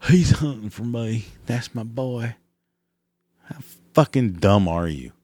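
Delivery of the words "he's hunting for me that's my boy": a hint of a smitten country girl. Mocking women admiring sticker.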